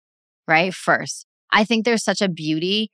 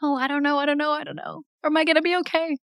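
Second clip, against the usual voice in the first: put-on high, small frightened child voice; scared child voice; 10 semitones higher, faster than usual. Inner child part afraid, asking if it's okay, high scared voice. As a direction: voice the anxious little inner child. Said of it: "child parts that are like".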